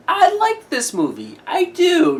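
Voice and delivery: insistent, high-pitched admission; voice going up high. Real, not acted. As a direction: let the voice jump up high while admitting you like it. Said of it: "my voice just went up higher".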